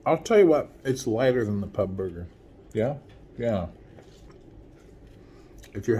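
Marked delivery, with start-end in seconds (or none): none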